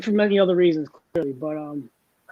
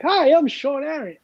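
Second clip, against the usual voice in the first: retold introduction; 7 semitones higher than usual. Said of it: "I'm like".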